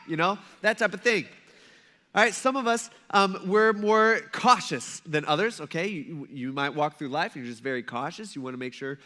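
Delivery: ordinary talk, nothing marked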